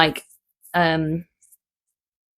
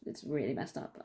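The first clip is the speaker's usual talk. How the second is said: normal talking voice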